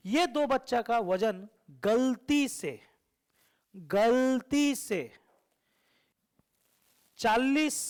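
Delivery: very slow, stretched words with a lift on each number; slow emphatic. Shown as a demonstration. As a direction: spell it out slowly so nobody misses it. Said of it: "मेरा बात ध्यान से सुनना". (धीरे-धीरे, हर शब्द पर ज़ोर)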